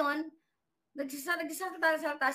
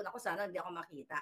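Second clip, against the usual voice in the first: quick, softer, lower mutter of a person hiding; anxious hush; 8 semitones lower, 7 dB quieter, faster than usual. Retold or imitated. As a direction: rush it under your breath, afraid to be found. Hiding, hoping not to be seen.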